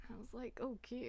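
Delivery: very soft, hushed swoon; swooning whisper. Acted. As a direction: drop right down to a whisper, smitten. Swooning over accent in her ear.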